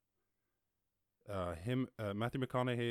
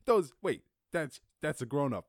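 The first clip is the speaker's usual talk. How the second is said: higher, louder mock double take; startled double take; 4 semitones higher, 8 dB louder than usual. Imagining a viewer seeing her grown-up clothes, startled and loud. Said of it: "That you're like".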